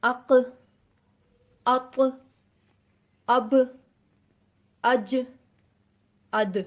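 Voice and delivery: اق اط اب اج اد each ending in a bouncing release; five qalqala letters bounced one by one; qalqala practice. Shown as a demonstration. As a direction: echo each final letter of اق اط اب اج اد. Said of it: "اس کو ہم practice کر لیتے ہیں".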